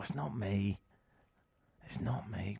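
quiet private dread; quiet inner thought. Retold or imitated. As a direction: say it under your breath, dreading it.

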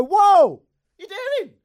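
high-pitched, incredulous outburst; appalled objector. Acted. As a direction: squeal in outrage at something tasteless. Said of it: "didn't someone go".

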